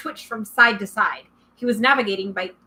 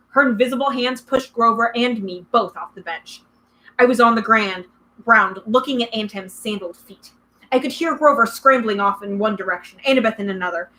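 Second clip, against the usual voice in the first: ordinary delivery, nothing marked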